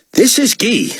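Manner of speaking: high, self-important announcement; announcing artiste. A narrator's reading. Artiste answering David's call, grand announcement. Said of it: "he announced".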